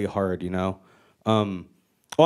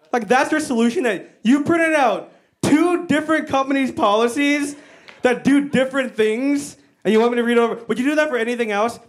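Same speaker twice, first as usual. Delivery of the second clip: incredulous rant; 8 semitones higher, 3 dB louder than usual. Acted. Comic outrage at HR's solution.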